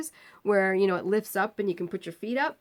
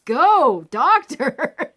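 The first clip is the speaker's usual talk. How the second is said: loud, raised whoop of support; gleeful cheer; 6 semitones higher, 10 dB louder, much slower than usual. Retold or imitated. Patient applauds the doctor silencing the screamer, gleeful cheer. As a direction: cheer them on at high volume.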